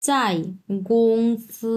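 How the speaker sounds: চাই গংসি stretched out very slowly; slow Chinese reading. Shown as a demonstration. Teacher reading the Chinese passage slowly. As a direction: read চাই গংসি word by word. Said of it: "কোম্পানির Chinese হচ্ছে গংসি".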